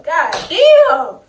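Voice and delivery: high loud yell, held back from the fight; high loud cry of frustration; frustrated yell. Retold or imitated. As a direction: shout it high and loud, straining to get back. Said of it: "I was like".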